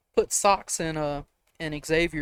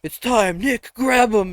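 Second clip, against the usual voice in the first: loud, raised rallying cry; mock urgent order; 6 semitones higher, 8 dB louder, slower than usual. Campmates ordering a friend to grab the boy, loud mock command. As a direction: call the attack with gusto.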